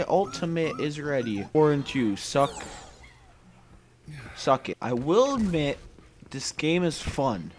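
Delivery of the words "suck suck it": normal talking voice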